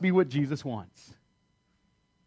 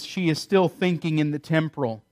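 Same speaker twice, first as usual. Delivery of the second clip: ordinary delivery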